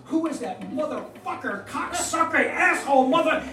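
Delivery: building into a high, loud rant of curses; acted angry yelling. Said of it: "I'm yelling at Rosie and saying".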